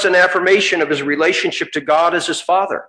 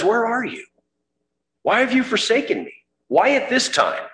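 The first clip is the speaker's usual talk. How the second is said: pleading cry; 8 semitones higher than usual. Acted. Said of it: "he says".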